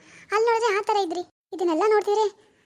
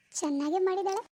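usual speaking voice

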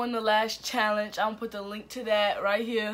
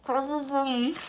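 garbled through a full mouth; cheeks stuffed full; 5 semitones higher than usual. Real, not acted. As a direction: force it out past packed cheeks.

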